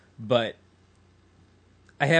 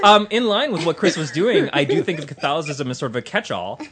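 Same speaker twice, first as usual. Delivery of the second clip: laughing; 2 semitones higher, 7 dB louder than usual. Real, not acted. Still laughing after the joke.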